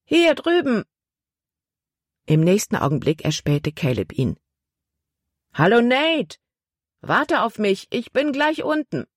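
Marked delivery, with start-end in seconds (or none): 0.0-0.8 high-pitched hail; calling out. A narrator's reading. Boy waving to his friend on the boat, calling out. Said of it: "rief er". 5.5-9.1 high-pitched greeting call. A narrator's reading. Ship's boy hailing his friend on shore, calling down.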